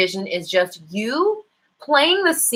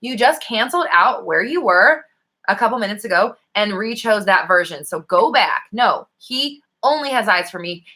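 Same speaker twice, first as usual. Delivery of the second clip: ordinary talk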